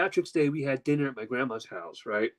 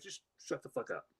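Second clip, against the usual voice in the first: usual speaking voice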